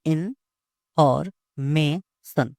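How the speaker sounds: "in और may, sun" as four separate beats; syllable by syllable. Shown as a demonstration. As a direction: pause after each piece of "in और may, sun". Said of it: "इसमें चार syllables".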